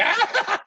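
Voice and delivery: high, manic little demon cackle; maniacal imp cackle. Acted. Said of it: "Jezebel is laughing maniacally".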